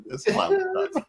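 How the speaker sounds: high, laughing, no emphasis; flat catchphrase, laughing. Retold or imitated. Mocking the movie's flat delivery, laughing. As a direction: say it casually, almost throwaway. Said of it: "He just kinda says it".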